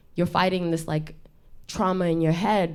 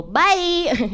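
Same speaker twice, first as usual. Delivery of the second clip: much higher, louder singsong bye; cheery ad sign-off, much higher; commercial sign-off; 13 semitones higher, 5 dB louder than usual. Retold or imitated. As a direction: chirp it like an ad ending. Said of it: "I always go".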